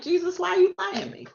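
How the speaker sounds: high, pleading mock complaint to Jesus; mock exasperation. Preacher retelling her prayer to Jesus, high mock exasperation. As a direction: whine it up high like you've been tricked. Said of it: "I'm like".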